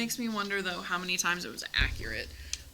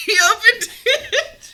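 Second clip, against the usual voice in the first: laughing through the words; giggling out loud; 16 dB louder, slower than usual. Real, not acted. Laughing as he opens candy.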